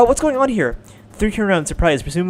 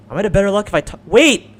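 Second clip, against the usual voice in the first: ordinary talk, nothing marked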